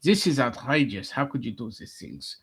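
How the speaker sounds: mock-outraged German accent. German refuses the immigrant's demands, mock German accent. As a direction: play an affronted cartoon German. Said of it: "speaking in a German accent".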